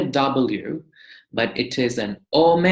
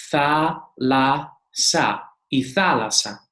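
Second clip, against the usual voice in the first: syllable by syllable. Shown as a demonstration. Greek teacher shows how 'thalassa' is read, syllable by syllable. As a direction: say 'thalassa' in separate beats, tha, la, sa. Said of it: "You see".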